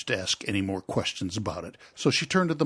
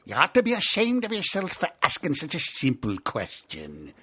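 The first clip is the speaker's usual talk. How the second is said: higher, louder reproachful voice; scolding Gryphon; 9 semitones higher, 7 dB louder than usual. Acted. Gryphon scolding Alice for a simple question, loud. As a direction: tell her off sharply.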